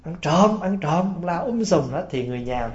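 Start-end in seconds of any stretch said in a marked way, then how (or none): 0.0-1.1 calling out thief, pitch raised, mock alarm; a father's half-acted cry of "thief"; retold yell. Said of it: "ông đánh cái phần la lên". (giả vờ la lên báo động)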